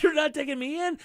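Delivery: outraged that the cop won't take him in; insulted disbelief. Retold or imitated. Caller retells the arrest, sounding insulted. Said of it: "You sound so insulted".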